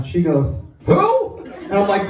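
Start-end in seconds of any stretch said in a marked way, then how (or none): 0.9-1.4 high, hooting question; put-on clueless grandma, pitched way up; mock bewilderment. Grandma pranking grandson after surgery, bewildered.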